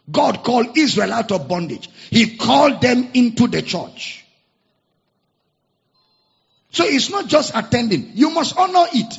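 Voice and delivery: loud, high preacher's cry; fiery preaching. Acted. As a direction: preach it to the rafters. Preaching at full force.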